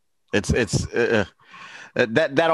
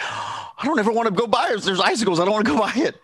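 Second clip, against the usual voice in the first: higher-pitched mock scared voice; retold fear; 7 semitones higher, faster than usual. Remembering being scared of icicles, mock frightened. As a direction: imitate a frightened refusal, pitched up. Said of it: "I remember being scared".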